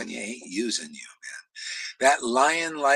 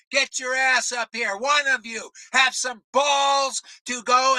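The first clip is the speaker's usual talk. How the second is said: shouted high, hurling a challenge at rival followers; fiery preaching; 13 semitones higher, 4 dB louder than usual. Acted. Preacher calls out rival gurus' followers, furious shouting. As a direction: preach at full force, daring them to come up.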